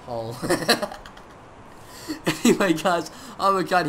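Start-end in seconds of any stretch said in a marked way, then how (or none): 0.4-1.2 short high-pitched laugh; delighted laugh. Real, not acted. Gamer delighted by a present, short laugh. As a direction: burst into a brief giggle.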